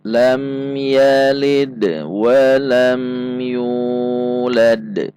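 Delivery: slow, drawn-out tajwid chant; Quran recitation. Shown as a demonstration. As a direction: recite it slowly like in the mosque. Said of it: "Mari kita baca". (bacaan tartil pelan dan panjang)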